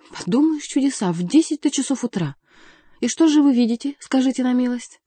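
high, lively scoffing; mocking retort. A narrator's reading. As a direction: scoff loudly in mock wonder. Mocking retort to the jealous count. (насмешливо, на высоких тонах)

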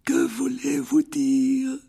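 put-on high, slow frail old wizard; old headmaster voice. A narrator's reading. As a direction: greet him like a frail elderly headmaster. (voix aiguë et lente de vieux directeur)

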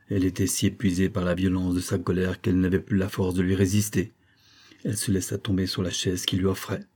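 ordinary delivery

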